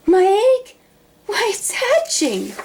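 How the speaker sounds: high, astonished cry; amazed. A narrator's reading. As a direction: marvel out loud as it hatches. Horton amazed at hatching.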